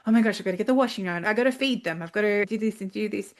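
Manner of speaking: hurried mum racing through her to-do list; breathless list of chores in a rush; frazzled rush. Retold or imitated. Frantic mum listing chores.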